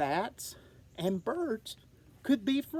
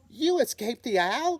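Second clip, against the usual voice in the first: higher, louder amazed cry; surprised cry; 5 semitones higher, 6 dB louder than usual. Acted. Young bat amazed her mother survived the owl. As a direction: gasp it out in disbelief. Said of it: "cried Stella Luna".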